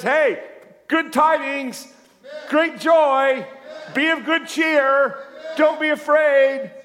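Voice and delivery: high, loud and jubilant, slowing on be of good cheer; Jesus bursting with great joy; joyful shout. Acted. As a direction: proclaim the good news at full voice. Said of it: "You know what he says".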